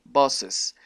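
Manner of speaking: bosses with an E sound before the S. Shown as a demonstration. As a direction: stretch bosses into two syllables. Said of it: "como si el apóstrofe se pronunciara como una E".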